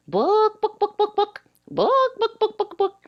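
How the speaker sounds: high squawky bawk bawk of a chicken; mock chicken clucking, book book. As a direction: cluck it out like a hen. Chicken in joke says book.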